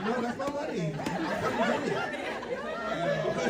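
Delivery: high, slow, squealing through laughter; can't get the words out for laughing; laughing insistence. Retold or imitated. To the bank about his wire, laughing hard.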